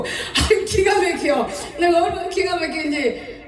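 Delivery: high, laughing exasperation; laughing disbelief. Real, not acted. Preacher recalling the gossip, laughing in disbelief.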